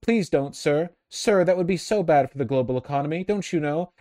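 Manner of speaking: mock groveling aide, high and pleading; pleading servile stewards. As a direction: beg the dictator meekly and politely. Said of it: "corporatist stewards are like".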